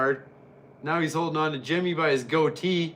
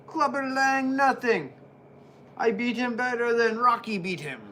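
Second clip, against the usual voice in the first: much higher cocky bragging voice; boasting wrestler; 7 semitones higher than usual. Pretend. Vlogger voicing Roddy Piper bragging, high cocky boast. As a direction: sneer at every rival he beat.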